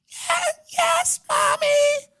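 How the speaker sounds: put-on tiny girl meekly agreeing; little girl squeaking yes to mommy. Daughter answers mother.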